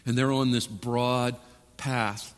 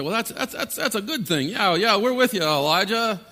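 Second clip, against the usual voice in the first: higher-pitched, halting crowd voice; crowd agreeing; 7 semitones higher than usual. Acted. People going along with Elijah, hammed-up agreement. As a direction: go along eagerly, stumbling into agreement. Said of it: "So people say".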